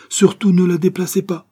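high, loud sudden order; urgent warning. A narrator's reading. Narrator stopping the doctor moving the body, urgent warning. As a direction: cut in to stop them at once.

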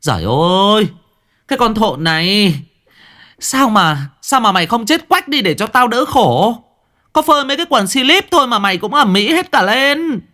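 put-on shrill, high old mother's furious scolding. A narrator's reading. Mother stamping her feet, scolding her daughter in a high furious voice. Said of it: "bà dậm chân bành bạch mà mắng".

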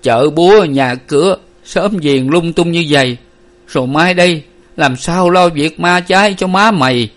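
high, plaintive fretting; moaning. A narrator's reading. Father frets over his wife's funeral, moaning. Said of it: "Ông Năm lại rên rỉ".

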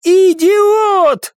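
very high, enraged shriek; furious scream. A narrator's reading. Baroness to the man she kicked, furious scream. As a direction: scream it in fury. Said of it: "прокричала баронесса с яростью".

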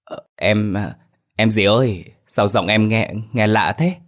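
hesitant, fearful stammering; trembling question. A narrator's reading. Driver asks strange passenger about her voice, trembling. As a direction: falter nervously and repeat words. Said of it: "Anh run run hỏi".

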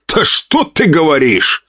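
high, slow, put-on astonishment; mock surprise. A narrator's reading. Count mocking his wife with feigned surprise. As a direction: fake being amazed. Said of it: "наигранно выпучил глаза".

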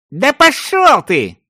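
very high, drawn-out indignant cry; shouted retort. A narrator's reading. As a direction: fling the insult back at him. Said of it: "выкрикнул ему мужчина с артефактом". (возмущённый выкрик)

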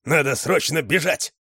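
loud, high cry to run; urgent shouted alarm. A narrator's reading. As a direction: yell at them to flee. Panicked dog urges escape.

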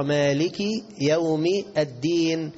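slow, separated "مالك يوم الدين" with ki; correct kasra. Shown as a demonstration. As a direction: spell out "مالك" with final ki, word by word. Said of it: "كاف مكسورة". («مالك» بكاف مكسورة على مهل)

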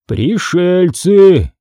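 very slow, drawn-out pronouncement; voiced chief, slow and deliberate; chief's deep voice. A narrator's reading. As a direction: stretch the word out like a solemn chief. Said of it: "неожиданно низким голосом".

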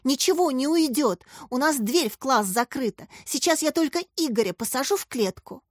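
ordinary delivery, nothing marked

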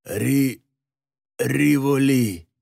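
slow, faltering dying murmur; slow broken mumble of a dying man; faint babble. A narrator's reading. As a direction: stumble weakly over the name. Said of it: "Альтенхайм пролепетал".

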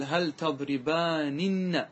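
slow تضربانن, sounded out as "tadribaaninna"; three nuns sounded. Shown as a demonstration. Teacher showing the underlying form, slowly sounding each nun. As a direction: draw out تضربانن, every nun heard through to "-ninna". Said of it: "بثلاث نونات".

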